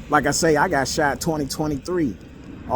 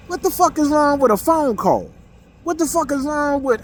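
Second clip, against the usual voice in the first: exasperated, much higher, at friends who never call; exasperated outburst; 9 semitones higher than usual. Real, not acted. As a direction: pitch jumps much higher in disbelief. Exasperated rant at absent friends.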